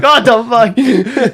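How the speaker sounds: high, loud, drawn-out imitation through laughter; laughing disbelief. Imitating a friend who just Googled the joke, laughing disbelief. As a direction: crack up while mimicking his disbelief. Retold discovery, cracking up.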